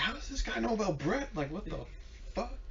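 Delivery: high, baffled question; retold disbelief. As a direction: sound stunned he knows. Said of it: "I was like".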